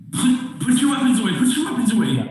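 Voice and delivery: high, urgent, fast command; urgent plea. Acted. Rhea urges the party to put weapons away, urgent. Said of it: "she looks at all of you and goes".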